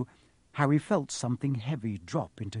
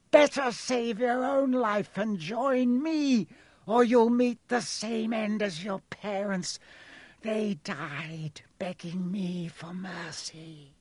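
snarled threat; 7 semitones higher, slower than usual. A narrator's reading. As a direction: threaten him slowly, sinking to a hush. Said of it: "snarled the face".

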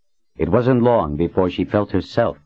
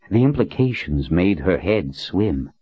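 ordinary delivery, nothing marked

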